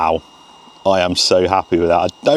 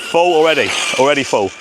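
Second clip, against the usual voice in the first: excited; 6 semitones higher, 3 dB louder, faster than usual. Real, not acted. Car hitting top speed.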